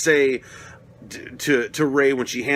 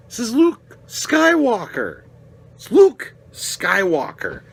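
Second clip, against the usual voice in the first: slow, higher, louder stress on Luke; indignant emphasis; 9 semitones higher, 4 dB louder, slower than usual. Real, not acted. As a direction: lean hard on Luke Skywalker, slowly. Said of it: "indignant fan rant".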